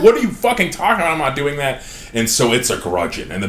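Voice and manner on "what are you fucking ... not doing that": outraged king. Retold or imitated. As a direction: shout it in disbelief, racing and high. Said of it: "I don't, like".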